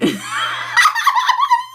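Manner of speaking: a real laugh breaking into a high shriek; shrieking laughter. Real, not acted. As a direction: cannot stop, laugh goes high. Laughing while trying to quote.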